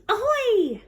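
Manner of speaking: put-on high baby pirate voice.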